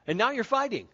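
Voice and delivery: usual speaking voice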